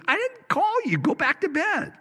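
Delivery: squeaky hammed-up Eli shooing the boy back to bed; high comic old man brushing him off. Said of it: "He goes".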